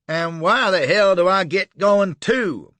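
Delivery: loud, slow Dixie bawl; Southern protest bawled loud and high. Acted. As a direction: bawl it to the heavens. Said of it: "bawled the Norfolk jacket".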